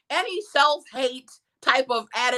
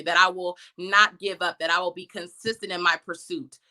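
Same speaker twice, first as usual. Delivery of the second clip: ordinary talk, nothing marked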